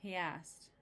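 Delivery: plain reading voice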